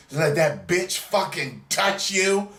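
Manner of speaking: hammed-up girlfriend complaint; mock jealous girlfriend. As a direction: sneer it like the girlfriend still holding a grudge. Girlfriend's grudge, mocked.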